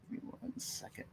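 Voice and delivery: barely audible, whispered stall; muttering while busy. Real, not acted. Presenter stalling while fixing screen share, muttering. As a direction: say it under your breath, preoccupied.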